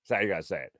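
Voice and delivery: usual speaking voice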